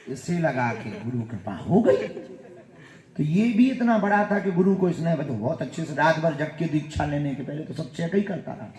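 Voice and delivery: ordinary talk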